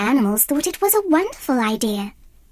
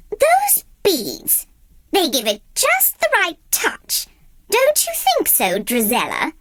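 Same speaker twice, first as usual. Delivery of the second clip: high, sly stepmother voice; 5 semitones higher than usual. A narrator's reading. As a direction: point it out with false sweetness.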